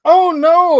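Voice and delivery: high, loud mock gasp of alarm; mock exclaim. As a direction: squeal it like a startled partner. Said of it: "exclaim something like".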